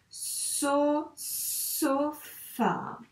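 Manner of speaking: "So, sofa" in long held syllables; syllables drawn out. Shown as a demonstration. Teacher reading back the written word, syllables drawn out. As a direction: stretch each syllable of "So, sofa".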